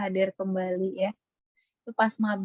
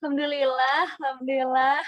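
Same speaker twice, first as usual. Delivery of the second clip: sing-song; 5 semitones higher, 4 dB louder than usual. Shown as a demonstration. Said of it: "kayak bernada gitu".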